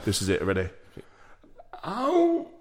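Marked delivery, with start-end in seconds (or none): 1.8-2.5 high giggly yelp of pain; method-acted ow. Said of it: "I got into character".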